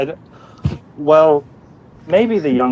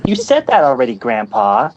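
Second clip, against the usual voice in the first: grandson impression. Shown as a demonstration. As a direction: cut in as the impatient grandson. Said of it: "I do have something prepared".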